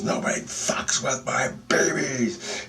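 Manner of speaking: slow, high, drawn-out warning; protective fury. Retold or imitated. Lady holds the creature, slow protective threat. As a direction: stretch out a mother's menacing threat.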